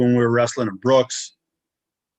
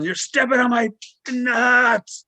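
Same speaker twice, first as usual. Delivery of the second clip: high, louder yell, dragging out nuts; retold yell; 12 semitones higher, 4 dB louder, slower than usual. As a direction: yell it at him so he finally hears. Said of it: "And I'm yelling".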